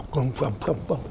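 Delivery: mock toothless gumming, soft and low; soft gummy mumbling noise; toothless chewing. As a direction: mimic an old tiger gumming with no teeth. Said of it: "Roshi's doing".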